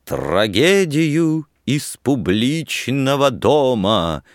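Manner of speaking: slow, stagey declamation pitched high; loud, theatrical. A narrator's reading. Student butting in with a mock title, actorly. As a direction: announce it like a ham actor, drawn out. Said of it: "вставил громко по-актёрски Борис Собашников".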